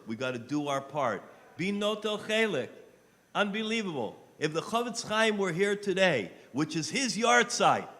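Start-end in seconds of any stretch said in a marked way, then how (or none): 1.6-7.9 loud, high sermon storytelling; fervent loud sermon story; preaching at full force. Real, not acted. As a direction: proclaim the sage's example. Loud preaching about the sage.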